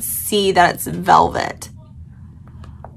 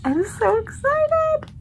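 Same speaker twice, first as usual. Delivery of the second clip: so excited; 4 dB quieter than usual. Real, not acted. Gushing over a new houseplant.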